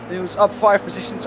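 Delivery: ordinary talk, nothing marked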